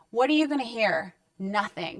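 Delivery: usual speaking voice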